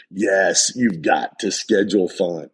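normal talking voice

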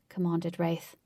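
usual speaking voice

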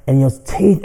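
ordinary talk

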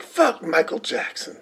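impression of Cat Williams ranting; Cat Williams bit. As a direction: rant incredulously like a comic. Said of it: "Cat Williams is doing this whole thing".

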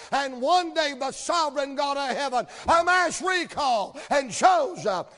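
loud, high preaching that builds to a shout; full-force preaching. Acted. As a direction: drive it home to the congregation.

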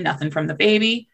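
ordinary delivery

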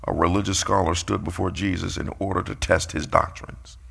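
ordinary talk, nothing marked

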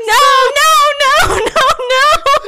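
high, loud wail breaking into laughter; mock wailing. Mom running home from the herd, mock wailing. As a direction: imitate horrified wailing while cracking up.

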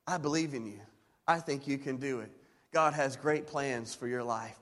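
put-on soft, tender words to a child; encouraging parent. Shown as a demonstration. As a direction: speak blessing over your kid gently. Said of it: "by speaking those words over them".

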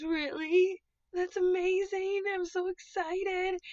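excited elephant. Acted. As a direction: squeal it like a thrilled storybook elephant. Voices excited elephant from picture book.

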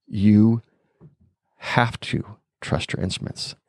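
retold warning, slow with weighty pauses; very dramatic. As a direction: imitate a dramatic instructor, drawing out the final words. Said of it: "he was very dramatic about it".

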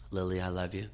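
put-on soft and tender to a restless toddler; softly telling his daughter he loves her; spoken softly. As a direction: hush it gently, like holding a child at night. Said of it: "I just spoke softly to her".

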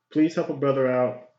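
normal talking voice

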